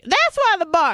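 high, loud mimicked nurse; exclaimed I-told-you-so. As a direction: call it out in a mock-emphatic high voice. Mimics nurse's exclamation after fainting.

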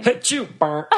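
high squealing laughter; laughing. Real, not acted. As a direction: burst out laughing mid-word. Cracks up at the idea.

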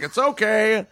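high easygoing guard. Retold or imitated. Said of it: "And he's like".

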